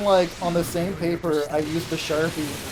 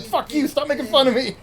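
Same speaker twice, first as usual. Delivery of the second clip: mock angry yell; 9 semitones higher, 4 dB louder than usual. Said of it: "she's like".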